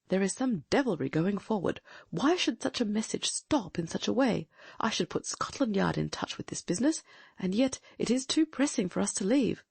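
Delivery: alert, intent gentleman detective; keen detective voice. Acted. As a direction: voice a sharp, watchful sleuth. Holmes voiced in a reading.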